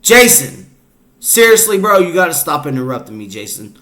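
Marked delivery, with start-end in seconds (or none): 0.0-2.0 exasperated, higher and louder; annoyed scolding. Real, not acted. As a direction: cut in firmly to scold an interrupter. Scolding the AI for interrupting.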